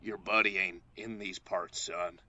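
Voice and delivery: a hint of a menacing cowboy drawl; tall rough cowboy. Retelling a made-up cowboy's warning to co-host, lightly mock gruff cowboy. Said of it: "he leaned over and he said".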